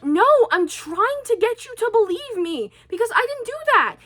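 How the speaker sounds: high, whiny retold objection; pleading protest. Child arguing with mom to be believed, high pleading protest. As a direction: protest your innocence, pitched well up.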